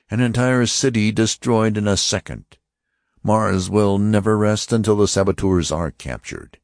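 high, stern voice of an alien officer; Martian officer. Acted. Martian officer warning the passengers, stern and high. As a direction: announce it like a cold interrogator. Lighter announces city destroyed.

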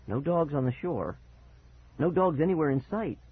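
usual speaking voice

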